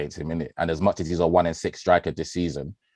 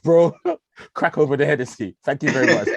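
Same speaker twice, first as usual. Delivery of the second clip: high, loud, laughing celebration; laughing excited; 9 semitones higher, 5 dB louder than usual. Retold or imitated. Fan celebrating the equaliser with his friend, high laughing cheer. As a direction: crack it open, cheering through a laugh.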